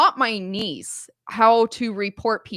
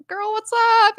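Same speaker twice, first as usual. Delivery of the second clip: put-on high, louder cheery hey girl; excited greeting; 12 semitones higher, 5 dB louder than usual. Aunt picking up her niece's call, bright and excited. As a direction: greet her like you're thrilled she called.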